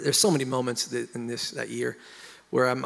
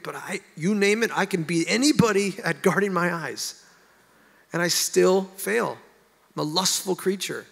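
pitched-up, insistent protest; exasperated plea; 4 semitones higher than usual. Retold or imitated. Telling God all the efforts that still failed, exasperated. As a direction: plead your case in rising frustration. Retold frustrated complaint to God.

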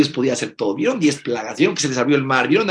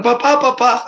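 high, loud panicked cry for papa; child screaming; 11 semitones higher, 8 dB louder, slower than usual. Acted. Frightened child shouts for papa as a dog attacks. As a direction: scream for your father as the dog lunges. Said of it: "empezó a gritar".

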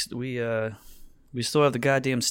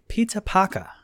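higher-pitched caricature British pronunciation; mock British accent; 7 semitones higher than usual. Host reading a tweet mocking British moviegoers, mock British accent. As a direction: drop the R's like a cartoon Brit. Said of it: "British people watching Spider-Man be like".

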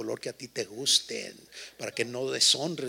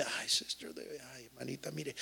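hushed, breathy imitation of the wife; soft pleading wife; 11 dB quieter than usual. Pastor mimics his wife pleading, in a soft hush. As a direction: soften it like a clingy sister. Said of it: "mi esposa puede estar una hora".

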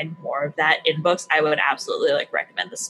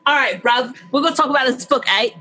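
hammed-up fake British accent, a touch louder and higher; bad British accent; 5 semitones higher, 3 dB louder than usual. Podcast host mocking co-host, in a bad British accent. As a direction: play at being British with tag questions, badly. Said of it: "bad British accent".

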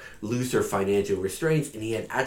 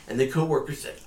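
normal talking voice

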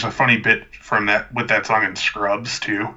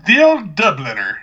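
mock Irish accent; a hammed-up Irish brogue; 5 semitones higher, 6 dB louder than usual. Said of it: "That's not an Irish accent".

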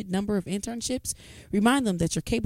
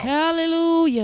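preacher-style hallelujah; 8 semitones higher, slower than usual. Acted. Churchy response.

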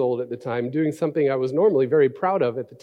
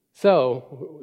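usual speaking voice

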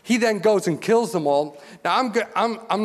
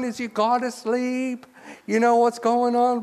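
mocking taunt; 4 semitones higher than usual. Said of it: "he was laughing".

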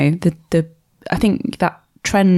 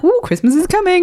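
high, sing-out pretend excitement; put-on festive glee; mock anticipation; 11 semitones higher than usual. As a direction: imitate someone thrilled about the holidays. Mimics what characters didn't say.

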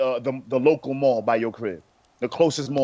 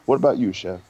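ordinary delivery

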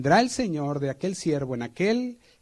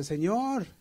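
high begging plea; pleading; 6 semitones higher, slower than usual. Retold or imitated. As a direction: beg to be saved. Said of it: "Le dice".

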